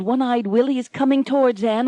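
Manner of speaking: ordinary delivery, nothing marked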